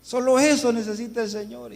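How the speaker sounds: loud, high-pitched preacher's exhortation; rousing pastor. Retold or imitated. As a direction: imitate a pastor firing up the congregation. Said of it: "aquel pastor que decía".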